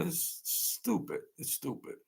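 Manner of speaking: breathy, hushed scorn; whispered. Real, not acted. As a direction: drop to a whisper, shaking your head. Scorn at guys telling on themselves.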